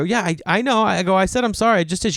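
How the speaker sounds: high-pitched, harried protest; mock defensive. Golfer insisting to the woman he apologised, mock defensive.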